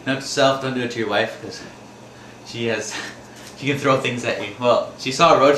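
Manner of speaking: ordinary talk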